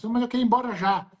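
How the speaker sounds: the patient rushing to get out of there; urgent insistence. Retold or imitated.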